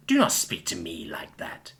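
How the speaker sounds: low, slow, menacing villain voice; deluded villain. Acted. Villain to hero, low slow deluded menace. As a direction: speak low like a mad self-made dragon.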